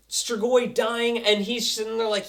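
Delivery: normal talking voice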